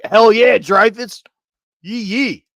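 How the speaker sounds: hollering yee yee at a rally; cheering crowd. Retold or imitated. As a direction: whoop like a fan in the crowd.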